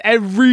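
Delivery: "Every" called out high and loud; stretched every. Shown as a demonstration. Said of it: "That's what I'm saying".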